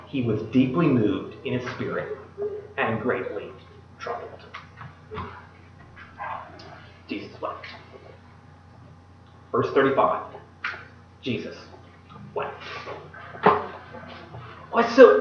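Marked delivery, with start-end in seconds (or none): 0.1-7.7 much softer, fading to a breath; hushed reading. A narrator's reading. Reading Jesus weeping. 11.2-12.6 much softer, barely voiced; hushed. A narrator's reading. Repeats verse softly.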